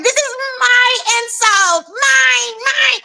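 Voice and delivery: high-pitched imitation of a tantrum; squealed, grabby mock protest; mock possessive. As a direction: stake a childish claim, hammed up. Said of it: "She is so possessive".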